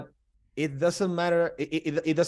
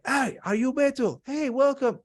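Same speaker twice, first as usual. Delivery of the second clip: much higher, bright greeting voice; excited welcome; 12 semitones higher than usual. Retold or imitated. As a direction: greet a visitor with surprise, like an old friend. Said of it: "the guy in the entrance were like".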